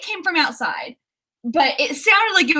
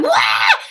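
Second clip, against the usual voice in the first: short, higher, louder startled scream; imitated scream; 5 semitones higher, 5 dB louder than usual. Telling viewers she wasn't muted, imitated scream. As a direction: re-enact your startled yelp. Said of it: "'cause I screamed".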